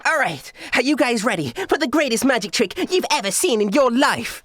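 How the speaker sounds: high-pitched hype to the crowd; excited showgirl announcement; stage magician showman. Acted. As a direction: build up the big trick, theatrically high. Magician hypes her greatest trick.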